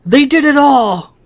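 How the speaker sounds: Lily shouting as he is pulled away. A narrator's reading. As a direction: call out as you're dragged away. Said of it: "Lily shouted".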